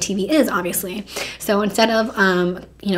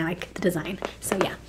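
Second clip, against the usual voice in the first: whisper; 3 semitones lower, 9 dB quieter than usual. Real, not acted. Trailing whisper.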